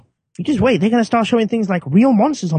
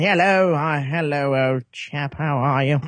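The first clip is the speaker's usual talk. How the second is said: posh West London gent, lower voice; West London accent; 3 semitones lower, slower than usual. Acted. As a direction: play an upper-class West London parrot. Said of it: "in the west of London".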